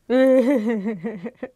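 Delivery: laugh. Real, not acted. Laughs at onions making you cry.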